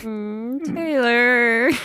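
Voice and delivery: choked up, voice breaking high; real tears after a friend's praise; proud tears. Real, not acted. Moved by friend's recorded message.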